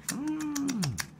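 high, soft mm of delight; a high playful yum sound; yummy mm. Acted. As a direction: hum like it tastes sweet. Yummy aphids for ladybug.

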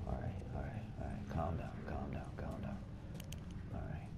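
tense whisper to calm nerves; whispered self-calming. Real, not acted. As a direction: soothe yourself under your breath. Calming himself while hiding.

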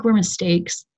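usual speaking voice

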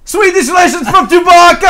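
very high, very loud Wookiee-style yell; Chewbacca shout. Pretend. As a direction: roar it out like Chewbacca. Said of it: "Let's find out".

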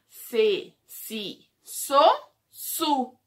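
slow, loud teacher chant of each syllable; syllable drill. Shown as a demonstration. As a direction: spell out every syllable for small kids.

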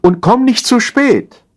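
slow, high-pitched calling; drawn-out wifely shout into the distance; calling after him. A narrator's reading. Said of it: "rief sie ihm nach".

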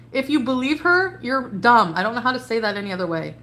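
ordinary talk

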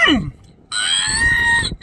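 1 delighted mmm. Real, not acted. Imagining Chick-fil-A sauce on it.

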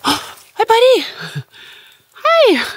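squeaky high greeting to a squirrel; high cute voice. Real, not acted.

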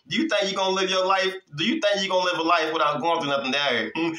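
usual speaking voice

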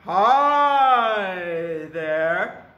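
loud, booming big goat voice; big billy goat. Acted. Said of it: "and he talks like this".

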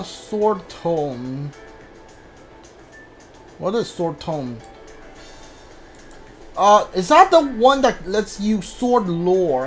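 ordinary talk, nothing marked

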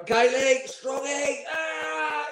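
very high, strained mock yell; high-pitched retold outburst, not full volume; exasperated manager. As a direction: imitate a manager crying out at the pranksters. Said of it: "He's gone like that".

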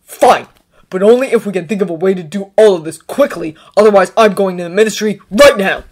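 loud, barked concession; curt, forceful ultimatum. A narrator's reading. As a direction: bark it out, holding back temper. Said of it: "instead barked out".

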